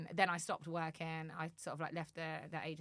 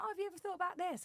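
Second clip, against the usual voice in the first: high mock offer; 13 semitones higher, faster than usual. People offering her chances.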